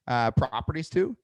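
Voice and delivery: ordinary talk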